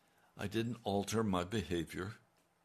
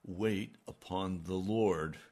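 slow and measured, each word set down; slow, solemn command; slower than usual. Retold or imitated. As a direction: lay the command down gently and slowly. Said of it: "And he said".

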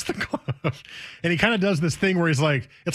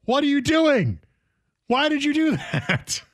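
mock wife scolding; 11 semitones higher than usual. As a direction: play his baffled wife. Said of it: "she puts her hands up like".

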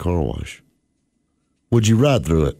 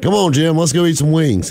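high, louder eager call; 10 semitones higher, 3 dB louder than usual. Retold or imitated. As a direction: talk a buddy into lunch. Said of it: "And I'm like".

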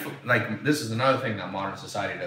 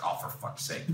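whispered, soft disgusted curse; muttered curse under breath; 10 dB quieter than usual. Retold or imitated. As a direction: breathe it out, deflated and annoyed. Disappointed by blind date.

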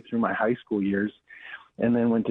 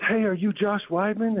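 a high, bright hail across the lot; rental clerk calling out; 10 semitones higher than usual. Retold or imitated. Clerk spots the pastor at the rental counter, calling out. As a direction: call out his name like you've been waiting for him. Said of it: "and this guy goes".

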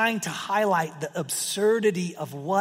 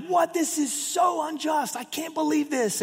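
much higher, indignant imitation of the crowd; outraged disbelief; 10 semitones higher than usual. First-century audience reacting to the unjust servant, high mock outrage. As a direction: play the scandalised listener, slower on "so unjust". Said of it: "been gritting their teeth and been like".

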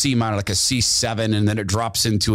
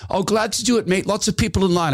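high, easygoing put-on voice saying "Lots of people in line"; Glenn's reply; 10 semitones higher, faster than usual. Glenn brushing off thanks because of the queue, in a high voice. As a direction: shrug it off cheerfully, pitched well up. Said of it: "He goes".

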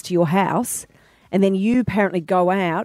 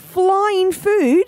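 high, louder amazed question; 11 semitones higher, 4 dB louder than usual. Real, not acted. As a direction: gasp it in disbelief. Amazed at food drones.

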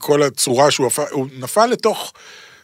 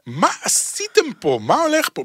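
baffled disbelief; 9 semitones higher than usual. Retold or imitated. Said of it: "ואתה אומר".

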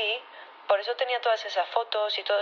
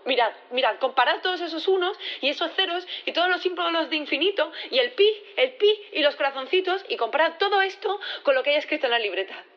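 high-pitched, insistent rush of proof; agitated, emphatic appeal; urgent pleading; 7 semitones higher than usual. Acted. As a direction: press them excitedly to look. Witness urgently proves the handwriting differs.

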